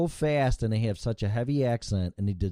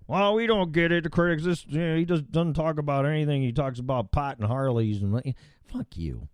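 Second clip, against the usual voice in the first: mocking the critics; 4 semitones higher than usual. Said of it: "just like this show".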